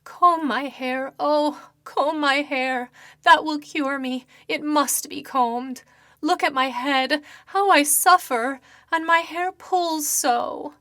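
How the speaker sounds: slow anguished ghostly entreaty; begging ghost woman. Acted. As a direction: she clings to him with desperate pleas. Ghost begs him to comb.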